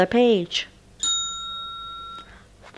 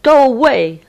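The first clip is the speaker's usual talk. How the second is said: loud barking command; big dog shout; 6 semitones higher, 7 dB louder than usual. Acted. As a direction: chase the kitten off, loud. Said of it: "said a big dog".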